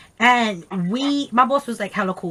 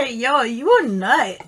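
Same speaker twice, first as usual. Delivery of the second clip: slow, laughing jab; amused teasing; slower than usual. Real, not acted. Friend teasing her soul sister about the story, laughing. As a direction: say it through a laugh.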